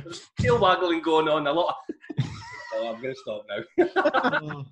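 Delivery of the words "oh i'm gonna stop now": giving up the story through laughter; laughing. Real, not acted. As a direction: try to stop while cracking up. Breaks down laughing.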